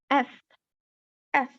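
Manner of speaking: hissed F, F breath; f sound. Shown as a demonstration. Teacher showing the sound of the letter F. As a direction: blow out F as a bare sound. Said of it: "make the sound of the letter F".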